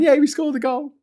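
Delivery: high, sarcastic celebrating voice; mock victory cheer. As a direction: cheer over one goal in a rout.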